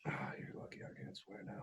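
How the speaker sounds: muttered under the breath, barely voiced; whispered aside. Real, not acted.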